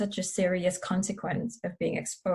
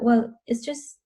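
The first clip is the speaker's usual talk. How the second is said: voicing an indignant skeptic, higher and louder; mock-offended, brushing it off; dismissive protest; 5 semitones higher, 5 dB louder than usual. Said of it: "they think that".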